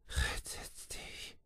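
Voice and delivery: slow, voiceless whisper; slow faint whisper. A narrator's reading. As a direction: barely breathe it, dying. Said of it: "flüsterte Swanson".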